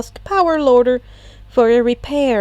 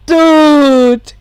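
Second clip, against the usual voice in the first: astounded; 4 semitones higher, 9 dB louder than usual. Acted. Kaminari astounded.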